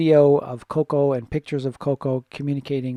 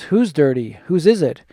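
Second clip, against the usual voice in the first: lightly acted stern questioning, louder on the questions; mock interrogation; 3 semitones higher, 7 dB louder than usual. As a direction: press the culprit, punching each question. Acting out trainer questioning the culprit.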